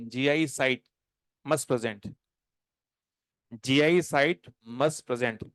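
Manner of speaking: slow, word by word; slow dictation. Shown as a demonstration. Teacher dictating a rule to students. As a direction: dictate each word slowly for writing. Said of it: "dictating to the class".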